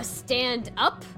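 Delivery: ordinary talk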